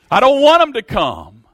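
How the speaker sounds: high, loud pleading cry from hell; desperate cry. Acted. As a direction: beg for mercy at the top of your voice, desperate. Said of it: "He was desperate".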